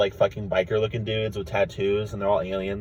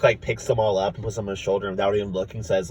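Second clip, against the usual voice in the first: ordinary talk, nothing marked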